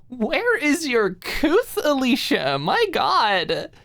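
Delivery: high, loud, slow outrage; mock scandalized. Maud feigning shock at Alicia's question. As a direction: gasp in mock horror.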